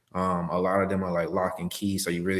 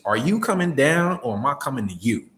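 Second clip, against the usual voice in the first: blunt version; 6 semitones higher, 6 dB louder than usual. Shown as a demonstration. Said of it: "But I'm not saying it like that".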